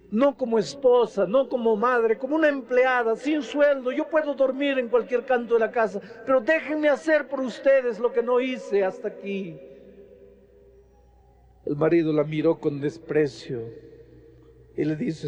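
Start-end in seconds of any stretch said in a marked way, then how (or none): 0.1-9.6 high, imploring plea; pleading wife. Retold or imitated. Repentant wife begging her husband and children, pitched high. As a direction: beg them, voice climbing higher.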